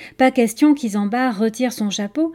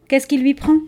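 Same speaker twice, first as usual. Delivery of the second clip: a quick, surprised question, raised and louder; startled question about the raven; 4 semitones higher, 6 dB louder than usual. A narrator's reading. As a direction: caught off guard by the crow's cry. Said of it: "demanda-t-elle surprise".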